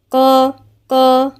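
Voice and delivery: slow isolated قا; heavy qaf. Shown as a demonstration. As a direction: repeat قا with a thick qaf. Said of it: "القاف مع الألف مفخمة".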